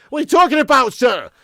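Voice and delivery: high, loud, incredulous retort; indignant outburst. Real, not acted. Livestream host rebuking a commenter about his club, indignant outburst. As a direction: raise the voice in sharp protest. Mock outrage at a rival fan's dig.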